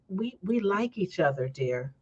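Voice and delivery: soft West Indian imitation, dropping away; West Indian accent. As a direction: lightly imitate a West Indian husband, softly. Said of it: "in his West Indian accent".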